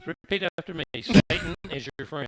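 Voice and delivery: distorted demonic devil voice. Real, not acted. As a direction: let the glitch make it sound like Satan. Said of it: "You sound like the devil".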